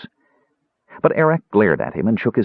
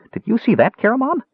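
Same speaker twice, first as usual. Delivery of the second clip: high, eager voice; high-pitched excited question; excited kender; faster than usual. A narrator's reading. Excited kender admiring the ogre.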